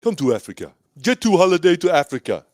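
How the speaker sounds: put-on playful African-accented holiday pitch. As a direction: sell it like an ad in a mock African accent. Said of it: "Rate my African accent".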